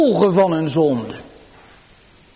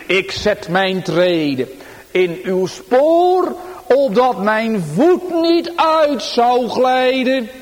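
slow, raised solemn psalm reading; psalm recital; 5 semitones higher, slower than usual. Acted. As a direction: lift the psalm line up slowly. Sung/recited psalm verse opening. (plechtig voorgedragen psalmregel)

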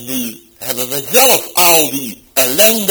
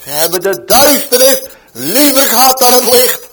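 loud, higher call with duisternis hit hard; shouted across the market; 8 semitones higher, 9 dB louder than usual. Retold or imitated. Man shouts the verse back across the market, loud call. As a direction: cry it out like a street preacher. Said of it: "riep het hard uit over de markt".

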